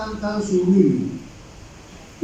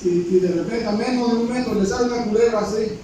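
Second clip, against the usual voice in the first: ordinary talk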